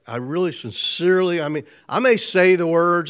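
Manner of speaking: ordinary talk, nothing marked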